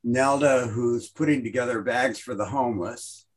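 ordinary delivery

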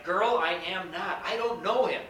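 Peter's loud, defensive denial; forceful denial. Acted. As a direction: deny it outright, raising the voice. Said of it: "He replied".

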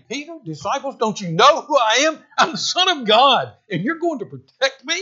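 Jesus, high and incredulous, rebuking Peter; Jesus asking his disciples if they know who he is; voicing Jesus. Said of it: "Jesus was saying".

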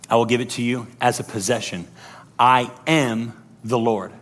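low, hushed, measured reading; solemn scripture reading. Acted. Preacher reading God's promise to Israelites, solemn and low. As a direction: read it gravely, dropping low and soft.

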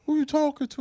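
high-pitched indignant retort, imitating the kid; mock a kid talking back; mad kid. Coach imitating a kid talking back, indignant. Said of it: "he gets super mad at me".

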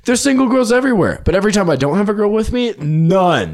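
frustrated friend venting about always meeting only couples at bars, voice pitched high; worked-up rant. Real, not acted. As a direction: pitch it way up, fed up with the pattern. Exasperated rant about bar pattern.

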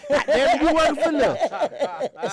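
high, drawn-out mock scolding; old lady arguing back. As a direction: put the driver in his place, hammed up. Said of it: "she say".

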